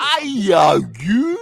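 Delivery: high, loud Scottish "Aye, you"; Scottish accent. Retold or imitated. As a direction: bark it like a drunk Scot. Mock Scottish drunk.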